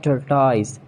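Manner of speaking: "Tortoise" as TUR-tyze; long I ending "Tortoise"; mispronounced tortoise. Shown as a demonstration. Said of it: "not tortoise".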